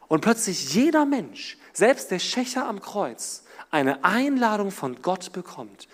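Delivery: ordinary delivery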